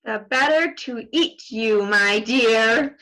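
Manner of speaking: loud menacing wolf; wolf pounces. Acted. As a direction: lunge with the words. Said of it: "cried the wolf".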